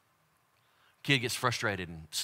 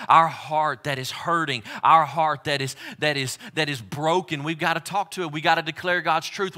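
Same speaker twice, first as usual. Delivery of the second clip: ordinary delivery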